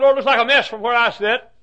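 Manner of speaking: high, loud complaint to heaven; anguished protest. Acted. Troubled son answering the Lord back, high loud protest. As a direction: plead your case loudly.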